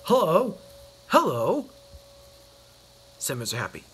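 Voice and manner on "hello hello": cheerful greeting. Acted. Mister Happy greets cheerfully.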